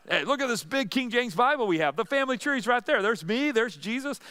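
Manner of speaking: lightly voiced, excited John; playful John. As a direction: play John as a proud kid pointing at the family tree. Playing John showing family Bible.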